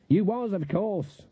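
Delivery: high, drawn-out dismissive concession. A narrator's reading. Granny brushing off Nanny's objection, dismissive. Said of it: "she said dismissively".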